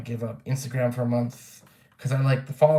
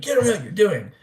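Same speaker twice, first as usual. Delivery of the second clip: higher, louder mock yell; retold yell; 9 semitones higher, 5 dB louder than usual. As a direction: snap at him from family. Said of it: "they yelled at me".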